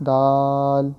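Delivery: دال held long and loud; drawn-out letter name. Shown as a demonstration. Teacher spells for students, long loud دال. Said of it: "without spelling پڑھنا ہے".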